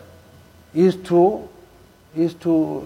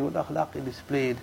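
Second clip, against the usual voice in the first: the repeat drops lower and much softer, almost under the breath; softer, lower echo; 4 semitones lower, 11 dB quieter than usual. Shown as a demonstration. Preacher quietly repeating the Prophet's akhlaq, hushed. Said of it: "repeats the line twice".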